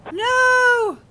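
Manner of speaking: long, high, full-voiced wail; anguished shout. Real, not acted. Streamer sees the officer killed, anguished shout. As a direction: cry out in disbelief at a disaster.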